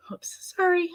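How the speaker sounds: startled high oops; surprised oops. Real, not acted. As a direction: yelp it out suddenly. Bumped something mid-sentence.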